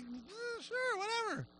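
high, soft shrug of a voice; breezy dismissal. Retold or imitated. God granting an easy request, airily unbothered. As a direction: toss it off lightly, high and quiet.

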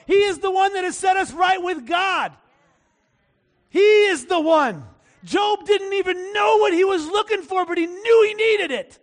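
loud, high-pitched preaching at full force; impassioned preaching. Real, not acted. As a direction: proclaim it to the congregation, driving each statement home.

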